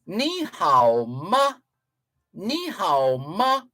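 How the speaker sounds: "Ni hao ma" said slowly in Mandarin, rising ma; Mandarin phrase. Shown as a demonstration. Host showing how Chinese speakers ask a question, slow Mandarin. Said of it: "they would ask".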